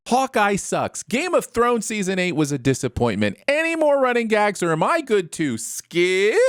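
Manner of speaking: high-pitched comic tirade of refusal; worked-up rant, pitched well above normal; mock outrage. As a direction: reel off objections in exaggerated indignation. Comic outburst refusing a pandemic plot.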